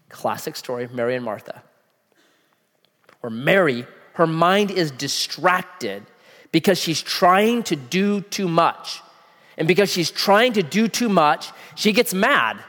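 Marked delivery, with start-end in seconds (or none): none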